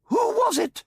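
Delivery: very high, loud shrill alien; very high excited shriek from Alpha Centauri. A narrator's reading. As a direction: squeal it out in excitement. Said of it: "shrilled Alpha Centauri excitedly".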